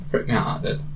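whispered and breathless; hushed. Real, not acted. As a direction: say it under your breath. Recalling fright, hushed.

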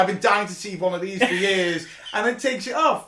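high, loud, laughing storytelling. Real, not acted. Podcaster recounting the silly Dalek reveal, laughing loudly. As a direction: tell it through giggles. Laughing retelling of Doctor Who.

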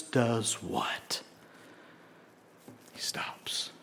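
hushed, slow whispered reveal; preacher whispering that Jesus stops. Real, not acted. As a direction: draw them in with a whisper. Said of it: "Get that picture in your head".

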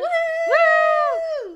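loud high whoop; excited cheer. Real, not acted. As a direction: cheer at the top of your voice. Celebrating episode ten.

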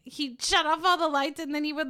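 usual speaking voice

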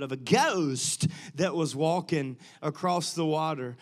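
mock South African accent with a big, loud "ghost". Shown as a demonstration. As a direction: put on your best South African accent. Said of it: "this is my best South African accent".

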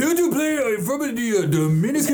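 high mock Dominican accent. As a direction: squeak it out in a put-on accent. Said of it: "mimics accent joke".

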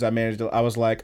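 ordinary delivery

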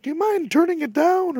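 put-on very high, timid little-kid voice; tiny kid. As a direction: squeak it like a scared small child. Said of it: "I was a tiny fucking kid".

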